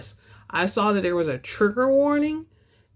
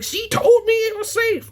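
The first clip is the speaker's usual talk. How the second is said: high, louder mock whine of an upset reader; mock complaining reader; 14 semitones higher, 3 dB louder than usual. To viewers, imitating an upset reader in a mock whine. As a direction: squeal it like a reader who feels misled. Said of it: "I don't want you to be like".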